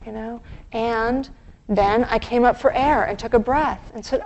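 ordinary talk, nothing marked

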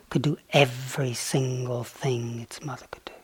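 hushed, unvoiced whisper of the key line; whispered punchline. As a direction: breathe the secret out softly.

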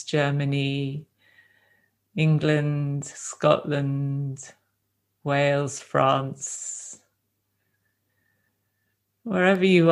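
gentle, unhurried meditation guidance with long silences between phrases; guided meditation. Real, not acted. Teacher guiding a group to rest in the body, calm meditation voice. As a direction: ease them into stillness, leaving space after each phrase.

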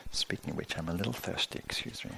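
a quick whispered excuse me; whispered aside. Real, not acted. Teacher whispering to the class as she pauses to drink. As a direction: whisper it aside while reaching for water.

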